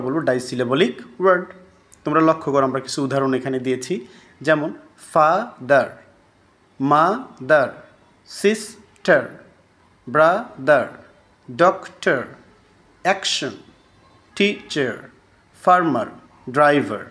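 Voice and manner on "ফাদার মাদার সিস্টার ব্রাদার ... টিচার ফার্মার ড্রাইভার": each word broken into two separate beats; teacher sounding out two-syllable words; split into syllables. Shown as a demonstration. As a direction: say ফাদার, মাদার and the rest in two parts, pausing between syllables. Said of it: "প্রত্যেকটা শব্দ উচ্চারণের সময় দুইবার করে".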